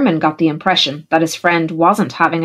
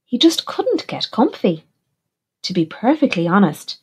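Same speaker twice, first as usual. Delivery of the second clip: ordinary delivery, nothing marked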